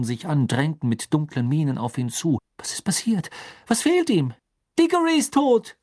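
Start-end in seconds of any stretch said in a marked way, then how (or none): 2.6-5.8 high cries building in loudness; alarmed shouts. A narrator's reading. Bystanders crowding round Harry, alarmed shouts. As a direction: call out in rising panic.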